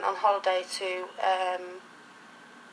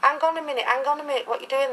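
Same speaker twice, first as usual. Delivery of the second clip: urgent protest; 6 semitones higher, 5 dB louder, faster than usual. Retold or imitated. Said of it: "I said to him".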